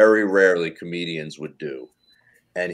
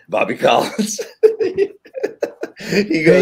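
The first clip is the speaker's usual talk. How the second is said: real laughter breaking through the words; amused by the cohost's guess, laughing; 12 semitones higher, 4 dB louder than usual. Real, not acted. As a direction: laugh while getting it out.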